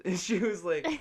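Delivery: ordinary delivery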